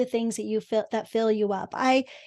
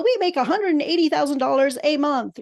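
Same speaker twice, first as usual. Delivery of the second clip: higher, louder imitation of a brag; mock boasting; 4 semitones higher, 5 dB louder than usual. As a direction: punch out the figure like a mock brag. Mimics guests' boastful bios.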